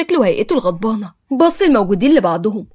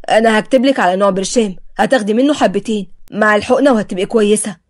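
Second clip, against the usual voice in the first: plain reading voice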